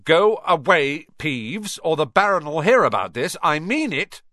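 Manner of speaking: loud, stern prefect warning; barked. A narrator's reading. Percy threatening Peeves, loud barked warning. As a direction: snap the order out with authority. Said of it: "barked Percy".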